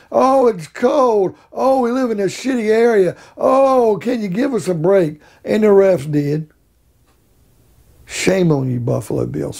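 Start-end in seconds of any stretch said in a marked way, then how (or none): 0.1-5.2 mock moaning. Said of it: "they're moaning and groaning".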